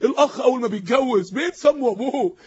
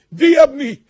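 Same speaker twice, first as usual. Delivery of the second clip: much louder scolding cry from the mother; mother's outcry; 4 semitones higher, 10 dB louder than usual. Retold or imitated. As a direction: blast it like a shocked mother. Mother scolding son's choice.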